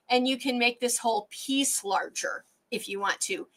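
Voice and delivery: normal talking voice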